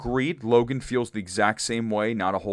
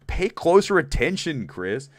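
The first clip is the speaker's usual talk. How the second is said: raised, playful telling-off; mock scolding; 7 semitones higher, 4 dB louder, slower than usual. Host kidding Chris for not listening, mock scolding. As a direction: chide them loudly in jest.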